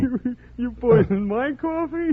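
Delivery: mock horror. [name] mocks Eric's accusation.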